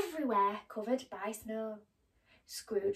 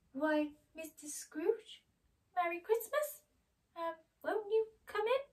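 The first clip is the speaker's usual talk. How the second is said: surprised Bob; 8 semitones higher than usual. Acted. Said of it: "he said".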